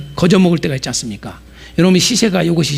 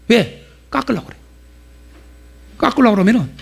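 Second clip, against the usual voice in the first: ordinary talk, nothing marked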